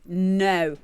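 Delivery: ordinary delivery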